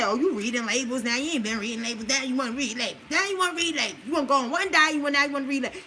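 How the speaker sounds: lightly mimicked naysayers; mock the people who nag you; hint of a nagging social-media heckler; mocking critics. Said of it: "Don't let them say".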